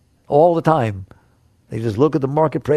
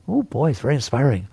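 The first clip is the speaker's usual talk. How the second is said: normal talking voice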